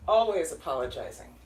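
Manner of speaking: ordinary talk, nothing marked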